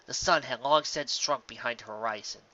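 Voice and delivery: plain reading voice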